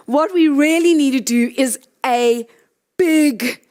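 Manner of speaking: ordinary talk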